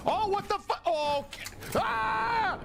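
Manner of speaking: scared yelps. Real, not acted. Jump scares.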